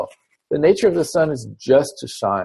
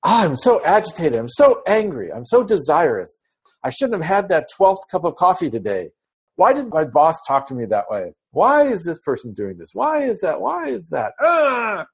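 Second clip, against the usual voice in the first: rising higher and higher, fretful and worked up; agitated pot; 5 semitones higher than usual. Acted. Teacher voicing the agitated pot, climbing into frantic pitch. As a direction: spiral up into a frantic, agitated complaint. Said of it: "that pot water is gonna be saying".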